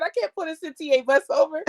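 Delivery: mock-protesting driver; husband on the bus line protesting; husband's reply. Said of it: "He said".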